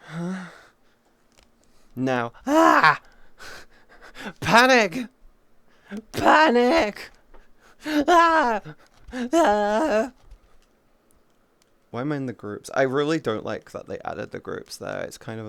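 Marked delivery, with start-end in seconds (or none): 2.5-10.1 high, loud panicked shrieks; hammed-up panic yells; mock panic. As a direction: shriek like you're losing it, playfully. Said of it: "I may need to panic".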